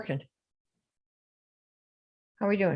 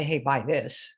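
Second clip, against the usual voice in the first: lower, deadpan hard-sell line; mock blunt pitch; 7 semitones lower, 3 dB quieter, slower than usual. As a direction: drop the voice for a blunt ask. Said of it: "You can't just go to people and say".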